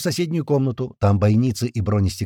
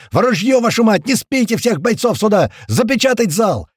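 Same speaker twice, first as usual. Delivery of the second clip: loud, high stream of orders; frantic yelling; 9 semitones higher, 6 dB louder than usual. A narrator's reading. Narrator calling everyone to arms, frantic yelling. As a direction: bellow commands to the whole hall. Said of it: "я орал, как потерпевший".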